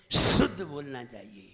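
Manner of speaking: शुद्ध opened with a loud whistling hiss; whistled sibilant. Shown as a demonstration. Preacher shows the whistle of correct speech, forceful sibilant on शुद्ध. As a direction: hit the first sound of शुद्ध hard, then fall away. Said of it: "अगर सीटी नहीं आती है".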